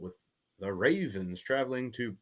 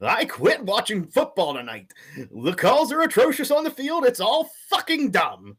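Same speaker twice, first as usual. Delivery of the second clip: much higher and louder, ranting like a furious fan; hammed-up furious rant, shouted high; over-the-top angry fan ranting at the refs; angry reading; 12 semitones higher, 10 dB louder than usual. Shown as a demonstration. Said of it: "read it from an angry point of view".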